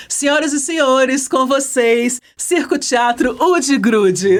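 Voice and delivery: high, slow ringmaster-style introduction; grand entrance announcement. Acted. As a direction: announce the act like a circus ringmaster. Host introduces the guest group.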